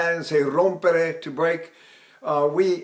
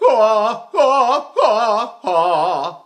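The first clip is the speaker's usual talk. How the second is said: sobbing cracks; 4 semitones higher, 7 dB louder, much slower than usual. Shown as a demonstration. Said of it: "I can sing like that".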